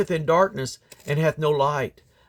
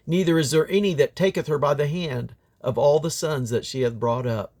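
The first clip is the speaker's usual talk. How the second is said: ordinary delivery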